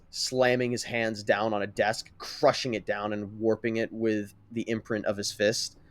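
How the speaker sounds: ordinary delivery, nothing marked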